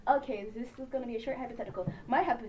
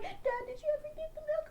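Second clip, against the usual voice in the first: put-on high kid voice; 8 dB quieter than usual.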